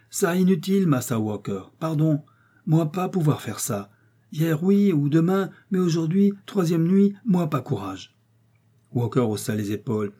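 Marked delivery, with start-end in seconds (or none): 0.1-8.1 higher, halting servant voice, broken French, refusing; frightened servant refusing. A narrator's reading. Servant refuses his master to stay the night, frightened higher plea. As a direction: apologise but fear wins.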